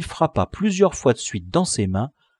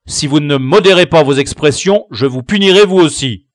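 stern teacher warning; 8 dB louder than usual. Acted. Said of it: "interrompit le maître".

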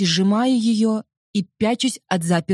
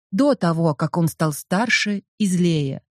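ordinary delivery, nothing marked

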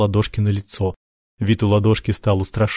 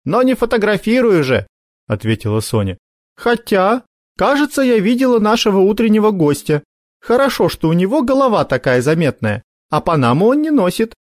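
put-on girl's voice; 11 semitones higher than usual. Sonya's high voice.

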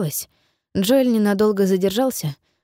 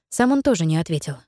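ordinary delivery

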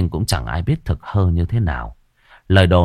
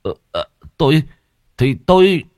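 stammering; 6 semitones higher, 4 dB louder than usual. Acted. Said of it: "ấp úng".

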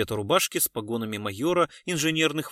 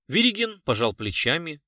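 ordinary delivery, nothing marked

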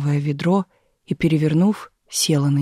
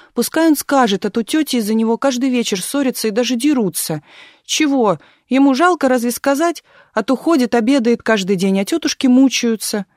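put-on insistent child; 6 semitones higher, 3 dB louder than usual. A narrator's reading. Child insisting, demanding answer.

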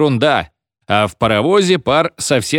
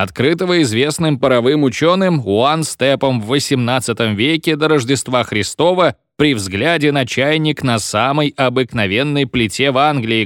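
ordinary delivery

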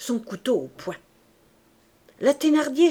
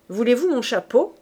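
called from the doorway; 3 semitones higher, 5 dB louder than usual. A narrator's reading. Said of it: "cria une voix".

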